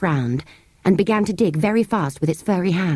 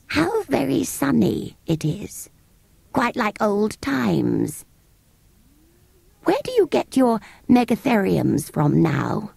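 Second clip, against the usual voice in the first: higher, slow, dreamy creature voice; musing sand fairy; 4 semitones higher, slower than usual. Acted. Sand fairy musing to the children about old times, slow. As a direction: savour the sun like an old beast.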